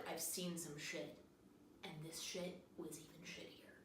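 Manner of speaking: low, dramatic whisper; grim hushed confession. Acted. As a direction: whisper it like a haunted war veteran. Hook-handed teacher's sad speech.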